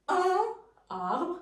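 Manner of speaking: un held high and separate, then a pause before arbre; wrong, split apart. Shown as a demonstration. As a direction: break un away from arbre. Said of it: "ce n'est plus".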